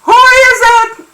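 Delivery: high, loud grandmother's shout; yelling. A narrator's reading. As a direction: demand loudly who is there. Deaf grandmother yells again.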